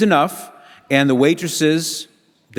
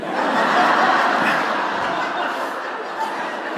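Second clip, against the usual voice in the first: quiet, airy chuckle at himself; soft breathy laugh; 4 dB quieter than usual. Real, not acted. Preacher laughs softly at his own story. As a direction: laugh under the breath at the memory.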